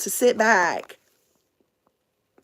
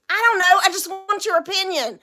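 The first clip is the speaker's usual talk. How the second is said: high, defensive husband imitation; mock bickering; 9 semitones higher than usual. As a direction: protest back in a squabble. Acted quarrel, son defends himself.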